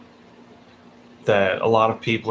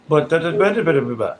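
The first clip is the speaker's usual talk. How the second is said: higher, louder nonsense-syllable mimicry; mock someone's yammering with da-da syllables; dismissive imitation of blathering; 5 semitones higher, 4 dB louder than usual. Mimics commenters' jokes with nonsense syllables.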